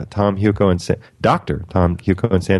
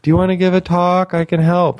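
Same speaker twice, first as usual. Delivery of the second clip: high, eager helper voice; imitating Nicole; 8 semitones higher than usual. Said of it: "I know".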